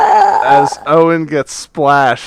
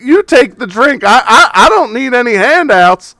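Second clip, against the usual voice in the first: high, loud hawker voice; donkey mascot pressing a free sample; pushy donkey mascot; 12 semitones higher, 7 dB louder than usual. Acted. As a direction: shove the free sample at him.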